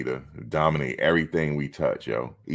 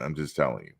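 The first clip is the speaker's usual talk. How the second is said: ordinary delivery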